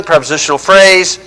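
usual speaking voice

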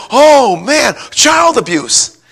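high, drawn-out mock gasp of alarm; mock shocked caseworker. Young caseworker hearing about a spanking, mock shocked. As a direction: ham up the young caseworker's horror, slowly.

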